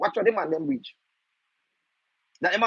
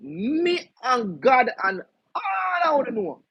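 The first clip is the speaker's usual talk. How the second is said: normal talking voice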